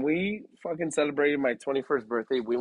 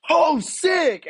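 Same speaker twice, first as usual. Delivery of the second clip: high, loud bro exclamation; hammed-up shout of delight; super loud waiter; 9 dB louder than usual. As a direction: imitate an overexcited waiter blasting it out. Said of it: "he's, like, super loud".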